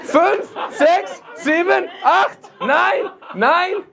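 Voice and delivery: very high, strident counting; mock German commander. Comic parodying a German counting out the date, mock commanding. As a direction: call out each word like a hammed-up drill.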